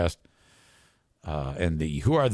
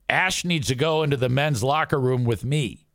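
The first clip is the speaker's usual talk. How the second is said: ordinary delivery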